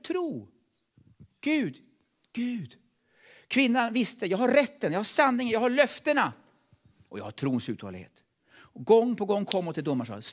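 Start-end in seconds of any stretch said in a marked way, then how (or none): 4.3-6.3 higher, insistent declaring of rights; bold declaration. Acted. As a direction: proclaim each claim with certainty. Widow's declarations, rising. (höjd, envis förkunnelse)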